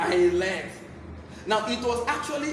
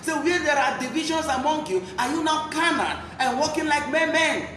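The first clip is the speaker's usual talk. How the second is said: fervent preaching; 3 semitones higher than usual. Acted. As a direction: preach it hard to the flock. Preacher exhorts the church.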